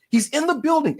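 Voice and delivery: high-pitched, incredulous insistence; exasperated insistence. Real, not acted. Host arguing to co-host Anna, exasperated. Exasperated point made in rant.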